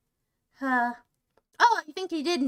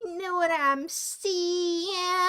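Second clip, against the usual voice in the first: put-on creepy old man; 2 semitones higher, much slower than usual. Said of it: "and he's like".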